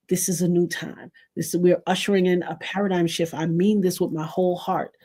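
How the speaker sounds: playful church altar call; mock altar call. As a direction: call them to the altar like a gentle preacher.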